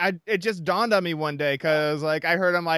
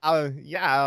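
ordinary talk, nothing marked

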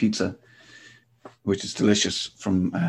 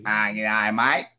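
put-on Sydney accent; slower than usual. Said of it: "the really harsh Sydney accent".

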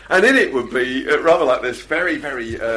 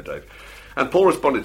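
normal talking voice

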